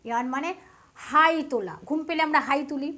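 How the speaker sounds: ordinary talk, nothing marked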